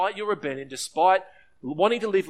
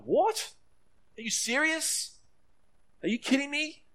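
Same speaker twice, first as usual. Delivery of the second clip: furious disbelief; 12 semitones higher than usual. Acted. Elder son hearing of his brother's party, higher and incredulous. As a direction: voice jumps higher in outraged disbelief. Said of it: "you can tell he's furious".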